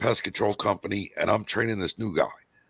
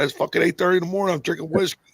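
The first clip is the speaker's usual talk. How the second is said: fast high outrage; 9 semitones higher, faster than usual. Retold or imitated. Retold protest at morning whiskey.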